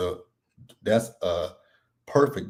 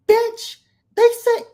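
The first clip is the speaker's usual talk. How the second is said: much higher and louder, mocking; high mock Whitney; 6 dB louder, slower than usual. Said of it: "Whitney quoted".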